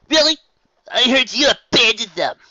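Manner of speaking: high, loud doubting voice; accusing captain. Acted. Wonderbolt captain accusing Rainbow Dash, high loud voice. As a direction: press the accusation.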